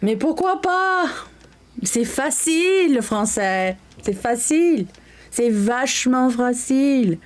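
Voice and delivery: французская речь с лёгким наигранным шиком, выше обычного; играючи изображает парижанку; playful French. Pretend. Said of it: "Is she speak French?".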